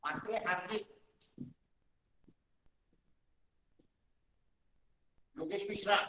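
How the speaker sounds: faint voice on a dying mic; very soft, far from the mic; barely audible. Real, not acted. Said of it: "Sorry, your voice is very low".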